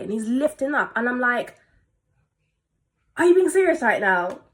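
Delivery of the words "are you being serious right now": loud, high-pitched affronted question; retold outraged disbelief; incredulous. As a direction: challenge them in disbelief. Retold reaction to insulting remark.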